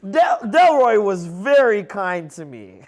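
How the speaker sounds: exaggerated, drawn-out fondness; mock tourist. Imitating a tourist back from the resort, mock-sincere praise.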